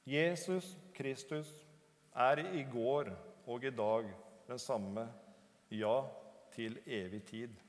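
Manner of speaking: slow, measured verse recitation; scripture recitation. Real, not acted. Preacher quoting Hebrews to the congregation, slow measured recitation. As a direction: recite it slowly, phrase by phrase, with pauses.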